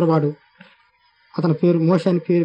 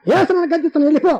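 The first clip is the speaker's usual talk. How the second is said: much higher, commanding rebuke; rebuking command; 9 semitones higher than usual. Retold or imitated. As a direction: drive the spirit out. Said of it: "అని చెప్పి గద్దించాను".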